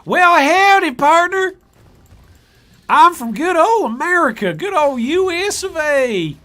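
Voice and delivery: very loud, high cowboy American accent; hammed-up American accent. Shown as a demonstration. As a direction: holler like a cartoon all-American cowboy. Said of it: "there's one American accent".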